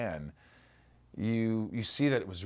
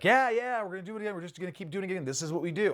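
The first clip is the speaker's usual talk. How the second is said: upbeat crew voice; 11 semitones higher, 3 dB louder, faster than usual. Retold or imitated. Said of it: "they're like".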